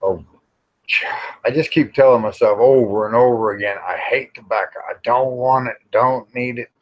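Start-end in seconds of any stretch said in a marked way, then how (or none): none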